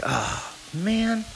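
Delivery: imitating a dismayed kid's groan; mock put-out child; retold exasperation. Daughter told to redo homework.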